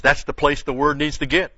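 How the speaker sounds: "that's the place the word needs to get" high and loud; insistent, punched "that's the place the word needs to get"; emphatic stress. Shown as a demonstration. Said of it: "he's stressing that".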